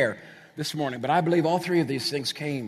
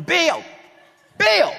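high, loud call across the room; 14 semitones higher, 7 dB louder than usual. Retold or imitated. Mother searching a packed party, calling out. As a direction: yell his name over the music.

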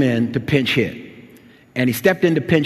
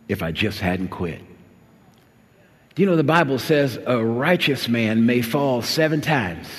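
ordinary talk

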